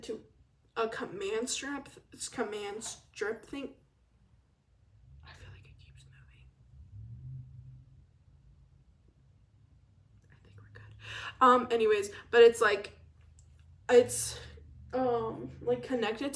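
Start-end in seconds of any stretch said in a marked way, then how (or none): 5.2-10.9 barely audible breathy whisper; whispered aside. Real, not acted. Whispering to herself while fixing the wobbly stand. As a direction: mutter it off-mic while fixing things. Fixing a falling gadget mid-recording.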